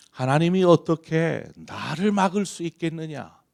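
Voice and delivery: high, loud, haughty taunt; arrogant, mocking Sennacherib. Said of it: "거만한 태도로 하나님을 조롱하던 사내림은".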